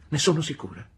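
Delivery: high, loud, mounting accusation; exclaimed reproach. A narrator's reading. Mother blames Pigotty for turning her boy, exclaiming indignantly. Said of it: "esclamò mia madre".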